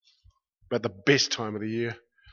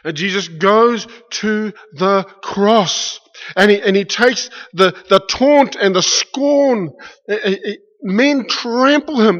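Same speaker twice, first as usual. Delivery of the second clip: loud, higher, slowed impassioned sermon; full-force preaching; 7 semitones higher, 10 dB louder than usual. Acted. Preacher telling the congregation how Jesus was trampled, impassioned loud preaching. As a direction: cry out the crucifixion to the congregation.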